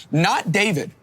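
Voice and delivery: usual speaking voice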